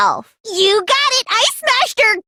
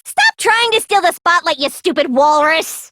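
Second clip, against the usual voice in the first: ordinary talk, nothing marked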